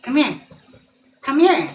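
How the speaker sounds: sing-song call across the room; calling the dog. Real, not acted. Streamer calls her dog over, high and loud.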